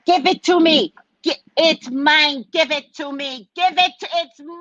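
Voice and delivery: put-on high, loud little-girl demand; high-pitched mock tantrum; bratty child tantrum. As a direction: play a spoiled kid yelling for a toy. Acting out bratty girl's tantrum.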